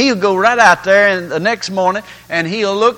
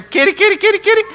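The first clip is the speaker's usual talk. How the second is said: very high, rapid kitty call; calling cats; 13 semitones higher, much faster than usual. Acted. Man wishing for his dog, calling the cat instead.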